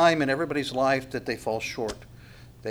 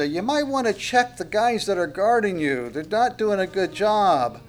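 high sing-out, as if to someone far off; hammed-up taunting call, stretched at the end; mock calling out; 5 semitones higher than usual. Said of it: "yelling out to him".

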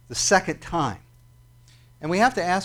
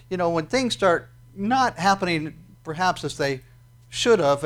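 usual speaking voice

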